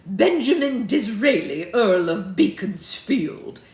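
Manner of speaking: loud, grandly drawn-out self-introduction; Benjamin Disraeli. Acted. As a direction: proclaim your own title slowly and loudly. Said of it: "said a figure with a".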